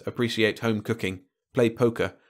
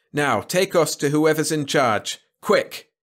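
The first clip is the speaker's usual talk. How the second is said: slow, higher order, ending on an urgent word; brisk command; 6 semitones higher, 4 dB louder, slower than usual. A narrator's reading. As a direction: give a firm order, then press sharply. Visitor orders the Malan to hurry.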